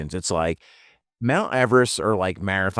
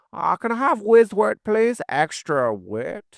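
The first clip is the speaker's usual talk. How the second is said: higher mock foreign accent, dragging out "Extra wit"; mystery accent; 11 semitones higher than usual. Ordering a cheesesteak as a foreigner, vague mock accent. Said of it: "I don't know what accent that was".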